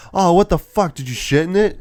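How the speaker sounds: high, loud disgusted yelp; hammed-up outrage at the taste; mock disgusted shout. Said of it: "he goes like".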